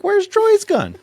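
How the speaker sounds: alarmed surprise. Retold or imitated. Realizing nobody has a gun near coyotes, high alarmed blurt. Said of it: "I'm like".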